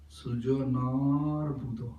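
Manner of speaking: Sujanar drawn out slowly on its long "naa"; slow, measured "Sujanar Buddha" with the long A held. Shown as a demonstration. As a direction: stretch the second vowel of Sujanar. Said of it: "A means long A".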